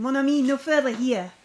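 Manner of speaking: light mock French accent. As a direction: play him French, firm. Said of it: "He's French".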